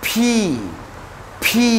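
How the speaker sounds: ফি as "phee", a p with a puff of air; drawn-out, breath-released p in ফি; aspirated P. Shown as a demonstration. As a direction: pop the lips on ফি, long ee. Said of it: "aspirated করে sound করতে হয়".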